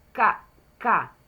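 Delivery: "ca, ca" as two separate hard-K syllables; K-sound demo. Shown as a demonstration. Teacher isolating the start of cara, hard K syllables. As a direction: punch each "ca" apart with a hard K. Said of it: "Aqui eu tenho o som do K".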